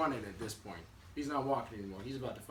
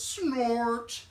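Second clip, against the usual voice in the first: loud, high drawn-out machine noise; word stretched into a mechanical blast; machine's snort noise; 10 semitones higher, 9 dB louder than usual. Acted. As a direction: blare the word like a big machine. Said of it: "it makes this noise".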